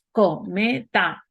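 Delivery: stretched out, syllable by syllable; slow demo word. Shown as a demonstration. Teacher slowly sounding out a word for students. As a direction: spell the word out slowly for the class. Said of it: "le quito la última sílaba".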